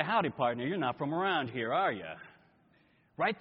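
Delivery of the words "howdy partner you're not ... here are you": put-on lower, folksy American Western cowboy accent; Western drawl accent. Preacher imitates a local out west spotting a stranger, in a lower Western cowboy accent. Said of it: "The accent gives them away".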